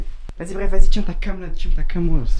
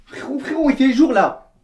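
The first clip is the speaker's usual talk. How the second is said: loud, high, indignant scolding; angry shouting; 8 semitones higher, 6 dB louder than usual. Acted. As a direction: tear into him for eating. Outraged at brother breaking fast.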